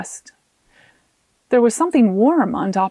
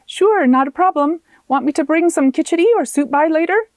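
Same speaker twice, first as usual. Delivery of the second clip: a lightly higher, cheerful friend's voice; friendly coworker; 6 semitones higher, 4 dB louder than usual. Acted. Kunda answers Arona's call, lightly higher and cheerful. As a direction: offer help brightly.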